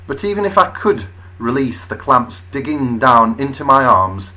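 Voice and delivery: usual speaking voice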